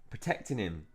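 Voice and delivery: usual speaking voice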